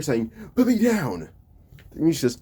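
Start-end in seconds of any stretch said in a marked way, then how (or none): 0.5-1.3 high, mock yelled plea; hammed-up panicked shout; retold scream. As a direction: yelp it out while dangling in the air. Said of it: "Hakai was screaming in the air".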